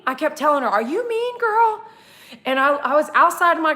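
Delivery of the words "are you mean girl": much higher wary question; high nervous asking. Retold or imitated. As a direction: ask the dog high and careful.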